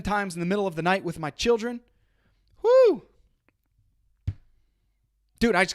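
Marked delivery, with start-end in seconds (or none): 2.6-3.1 high, loud whoo to pump himself up; excited whoop. Real, not acted. Pumping himself up for a coaching call, loud whoop. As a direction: let out a big rising whoop. Hyping himself up after tired night.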